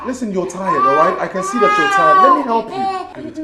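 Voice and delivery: loud, tearful pleading; sobbing and raising the voice to reach him; crying plea. Acted. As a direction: beg him through sobs to let you help. Pleading with a distraught man.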